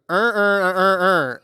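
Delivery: put-on stuck Baltimore accent stammer; mock Baltimore reader stalling. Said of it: "he reads it out and goes".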